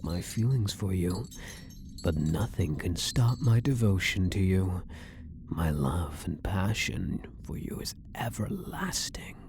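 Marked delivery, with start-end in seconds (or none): 5.5-9.4 soft, intimate whisper. Acted. Elf prisoner whispering eternal love to his guard. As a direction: breathe the vow close to his ear.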